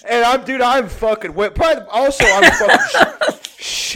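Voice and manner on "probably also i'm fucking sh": real laughter breaking the words; laughing. Real, not acted. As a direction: voice squeaks high through laughter. Narrator cracking up.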